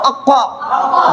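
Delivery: अक्का thick and rounded, stretched out slowly; मोटी आवाज. Shown as a demonstration. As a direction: draw out a full-mouthed heavy अक्का. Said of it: "मोटा करेंगे". (अक्का को मोटा करके, खींचकर)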